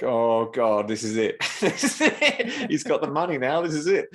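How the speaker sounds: sure he's about to be killed; mock terror. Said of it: "I'm like".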